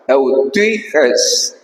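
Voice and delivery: eutyches con eu ligado al inicio; eutyches pronunciado. Shown as a demonstration. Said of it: "fíjate la pronunciación". (pronunciación de eutyches con eu)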